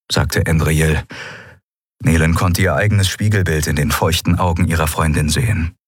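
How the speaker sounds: ordinary delivery, nothing marked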